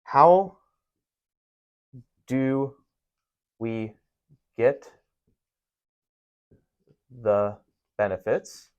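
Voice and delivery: slow word-by-word read-out; dictating slowly. Shown as a demonstration. Teacher dictating a sample line to the class, slow. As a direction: lay each word down as you write it. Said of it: "Here's a good one".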